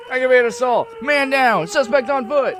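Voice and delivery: high, loud frantic radio calls; screamed into megaphone. A narrator's reading. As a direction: scream out police alerts. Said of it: "raises the megaphone and screams".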